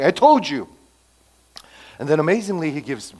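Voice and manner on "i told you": louder, higher, gloating; smug told-you-so. Retold or imitated. Preacher voicing Jonah sulking at God, smug. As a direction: say it like a sulky know-it-all. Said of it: "That's what, uh, Jonah is doing".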